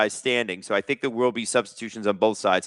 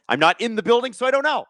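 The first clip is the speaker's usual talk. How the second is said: defensive; 9 semitones higher than usual. Real, not acted. Defensive about lacking information.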